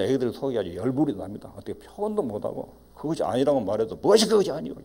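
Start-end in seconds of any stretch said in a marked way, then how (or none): 4.0-4.7 raised, higher mock retort; acted-out indignant dismissal; scolding parent. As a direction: cut off the protest like an imitated angry parent. Acting parent dismissing child's protest.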